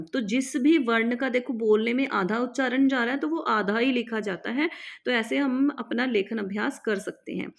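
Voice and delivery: ordinary delivery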